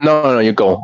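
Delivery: usual speaking voice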